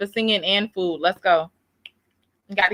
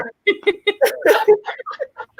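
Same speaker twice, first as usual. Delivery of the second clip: high laugh; 10 semitones higher than usual. Real, not acted. Laughs at the singing.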